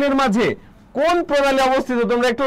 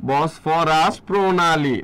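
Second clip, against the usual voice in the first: very slow, lower-pitched, drawn-out syllables; 5 semitones lower, much slower than usual. Shown as a demonstration. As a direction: break the name up slowly for learners. Teacher spells out the name slowly.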